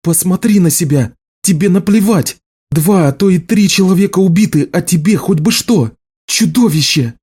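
high-pitched, outraged accusations; furious tirade. A narrator's reading. Healer rages at the marked man, high furious tirade.

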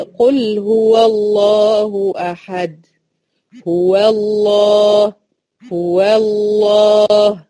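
قل هو الله أحد chanted very slowly, الله with a thick lam; heavy lam, recited. Shown as a demonstration. Teacher reciting Surat al-Ikhlas for students: heavy lam. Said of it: "الله هنا اللام لفظ الجلالة".

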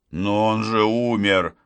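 ordinary delivery, nothing marked

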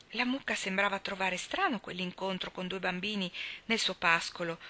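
ordinary delivery

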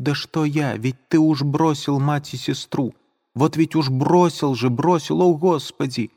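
put-on girl crying out in anguish; anguished outcry. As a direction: cry out in despair. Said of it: "вскрикнула она".

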